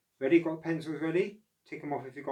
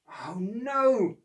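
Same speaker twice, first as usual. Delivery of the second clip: groan; 9 semitones higher, 6 dB louder than usual. Acted. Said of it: "groaned Oscar".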